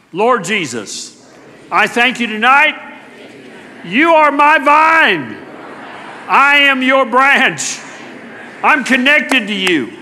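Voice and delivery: leading prayer. Real, not acted. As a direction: lead the church in a spoken confession. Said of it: "I'm gonna lead you into confession".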